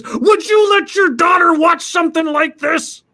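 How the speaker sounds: high, loud indignant yell; hammed-up furious shout; outraged shout. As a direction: demand it of a stranger. Customer yelling at another father.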